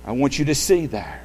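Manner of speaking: normal talking voice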